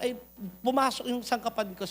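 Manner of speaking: ordinary talk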